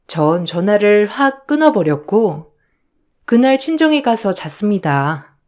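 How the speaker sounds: ordinary talk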